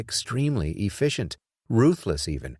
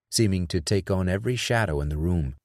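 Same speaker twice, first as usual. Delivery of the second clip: ordinary delivery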